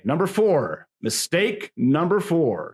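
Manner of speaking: hammed-up ringside billing; wrestling announcer. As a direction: announce the next bout with ceremony. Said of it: "my best wrestling announcer voice".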